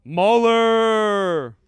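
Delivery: booming drawn-out name calls; stretched, shouted hype names; loud announcer calls. Acted. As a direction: call their names like a ring announcer.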